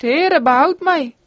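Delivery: a touch of a drowning man's cry; mild cry out. Acted. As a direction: call out as if sinking. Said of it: "riep hij uit".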